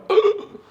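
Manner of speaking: sharp high intake of breath in mock shock; quick startled gasp, acted. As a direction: gasp like you just saw a bad rating. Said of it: "they're like".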